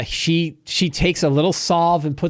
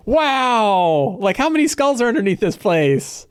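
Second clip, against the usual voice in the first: amazed wow; 7 semitones higher than usual. Retold or imitated. Party reacts to widow's story.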